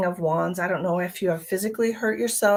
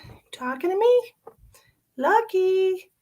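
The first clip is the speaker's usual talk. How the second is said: much higher, mock cat voice; cat's voice; 11 semitones higher, 4 dB louder than usual. Owner voicing her cat talking back, high mock voice. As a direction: voice the cat as if it answers. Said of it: "She's like".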